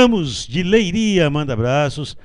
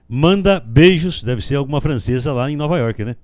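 normal talking voice

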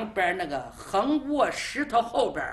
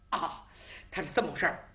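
ordinary delivery, nothing marked

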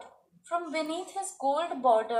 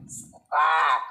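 violin squawk; 8 semitones lower, 7 dB louder than usual. A narrator's reading. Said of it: "Lali derailing again".

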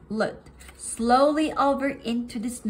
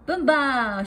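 a touch of a joyful yell; two friends shouting in play; loud shout; 3 semitones higher, 5 dB louder than usual. A narrator's reading. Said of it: "shouted Flop and Hop as loudly as they could".

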